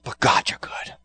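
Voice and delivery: breathy whisper of faith. Retold or imitated. Mourners at a funeral whispering their faith, hushed. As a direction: whisper it like a prayer. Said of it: "the other side was saying".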